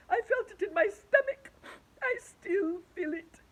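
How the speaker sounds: high, loud whiny sob; whimpering. Acted. The earthworm whimpers to the others, high and tearful. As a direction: whimper over a queasy stomach.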